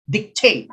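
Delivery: TATE punched in "dictate", light first syllable; second-syllable stress. Shown as a demonstration. Teacher sounding out the answer, "dictate" stressed on second syllable. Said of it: "letter D is second syllable".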